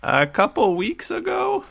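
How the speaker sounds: very high-pitched creature voice; Blurg Blurg. Acted. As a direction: answer in a squeaky high character voice. Game master answers as Blurg Blurg.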